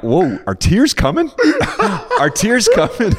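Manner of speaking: high, loud, laughing through the words; half-laughing at his own tears; laughing disbelief. Retold or imitated. Retelling to his co-host, high and loud, laughing. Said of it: "And I'm like".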